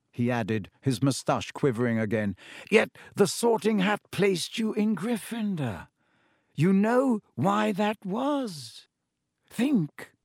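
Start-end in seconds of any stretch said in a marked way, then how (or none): 2.7-10.1 put-on higher, slow, coaxing old-man voice; probing old headmaster. A narrator's reading. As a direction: lead the boy to the answer. Headmaster prompts Harry.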